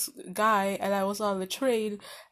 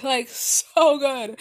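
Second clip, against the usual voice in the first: drawn-out, louder "so good" with a heavy "so"; stressed so good; 4 semitones higher, 8 dB louder, much slower than usual. Real, not acted. Gushing to viewers about the chat, slow and emphatic. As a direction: lean hard and slow on "so good".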